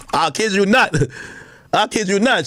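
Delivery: ordinary delivery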